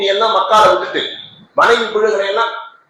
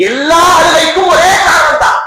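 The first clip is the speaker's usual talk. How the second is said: preaching shout; 9 semitones higher, 5 dB louder than usual. Acted. Preacher rising to climax.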